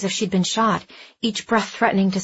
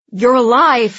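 loud, higher, choked-up outburst; choked relief; 4 semitones higher, 9 dB louder than usual. A narrator's reading. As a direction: burst out with overwhelmed relief. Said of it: "he choked out".

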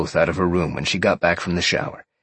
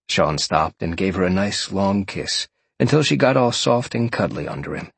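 plain reading voice

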